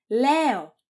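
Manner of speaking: loud shout to be heard. Acted. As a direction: shout it at someone who can't hear. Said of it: "φωνάζοντας".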